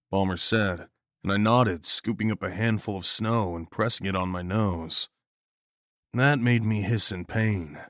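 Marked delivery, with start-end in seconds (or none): none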